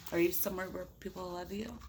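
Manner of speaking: ordinary delivery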